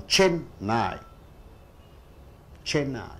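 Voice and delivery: Chennai, CHEN stressed and NAI falling away; stress on first syllable. Shown as a demonstration. Teacher repeating a noun example, stress on first syllable. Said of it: "When you stress the first syllable".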